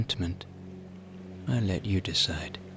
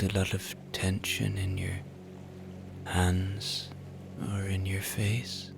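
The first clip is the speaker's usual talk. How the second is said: guided relaxation; 8 dB quieter than usual. Acted. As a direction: ease them toward sleep, unhurried.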